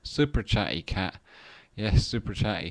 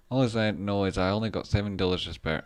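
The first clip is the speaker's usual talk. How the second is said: usual speaking voice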